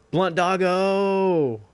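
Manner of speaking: loud, high, stretched-out call; long announcer-like whoop; drawn-out cheer. Pretend. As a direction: holler the name, holding the last vowel. Hyped call-out of emote name.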